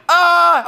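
high startled shout; startled yelp. Retold or imitated. Pastor reliving his shock at a big dog, high yelp. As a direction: yelp at a strange dog in the kitchen. I'm freaking out.

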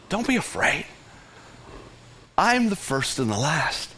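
soft, quiet, tender comfort; calm a frightened friend; Jesus comforting John, gentle and soft; gentle reassurance. Retold or imitated. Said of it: "like a gentle, friendly whisper".

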